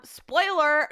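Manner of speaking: ordinary talk, nothing marked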